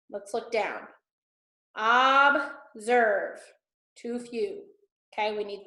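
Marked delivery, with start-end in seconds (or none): 1.8-3.4 observe split into two drawn-out syllables; deliberate syllable count of observe. Shown as a demonstration. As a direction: say observe slowly, pausing between its two syllables. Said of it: "count how many syllables".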